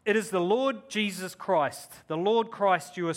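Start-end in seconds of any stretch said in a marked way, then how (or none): none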